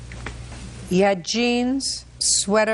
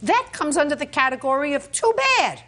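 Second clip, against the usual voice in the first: raised pitch, forceful dismissal; emphatic rebuke; 5 semitones higher than usual. Real, not acted. Judge telling a litigant her injury earns no sympathy, emphatic. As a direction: lay down the law, no sympathy.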